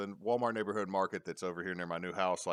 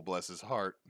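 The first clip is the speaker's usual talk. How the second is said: usual speaking voice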